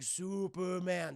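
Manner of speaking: very slow, stretched-out echo; childlike giant robot. Retold or imitated. Iron Giant repeating Hogarth's word, slow and childlike. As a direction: draw the name out in wonder.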